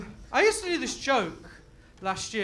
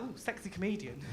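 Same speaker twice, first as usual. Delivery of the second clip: mock seductive; 4 semitones lower, 11 dB quieter than usual. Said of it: "look at me now, like".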